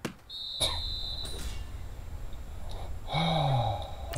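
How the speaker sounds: a long weary sigh. Real, not acted. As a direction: let out a long breath. Sighing after play.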